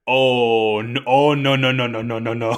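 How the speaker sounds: loud run of dismayed no's, tailing down; hammed-up dawning embarrassment; oh-no panic spilling out in a rush; mock panicked realization. Acts out Orga's horrified realization.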